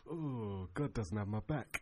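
low, quiet deflated realisation; dawning dread. Acted. Prophet realising halfway to the lions, mildly deflated. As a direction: sink into a soft, low "oh".

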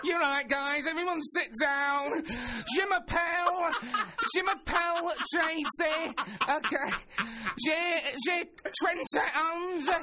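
hammed-up Manchester French teacher calling the class to order; mock French teacher. Lad mimics his French teacher addressing the class, high hammed-up Manchester accent. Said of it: "Any danger of a bit of accent".

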